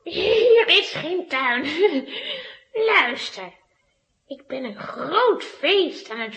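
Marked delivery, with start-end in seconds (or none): 1.7-2.5 sly teasing giggle; soft mocking witch laugh; witch's giggle. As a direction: laugh at the fool softly.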